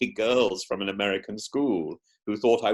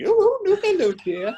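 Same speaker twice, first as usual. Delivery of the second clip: put-on much higher, motherly old nanny voice; Mrs Doubtfire; 5 dB louder than usual. Guest pretends to visit contestants as Mrs Doubtfire, high nanny coo. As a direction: coo like a sweet Scottish nanny. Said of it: "maybe as Missus Doubtfire".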